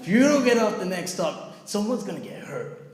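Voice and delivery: high, loud mock warning; shouted threat. Drunk passenger threatening the chanters, loud mock threat. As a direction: burst out loud, then ease off slightly. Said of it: "like this".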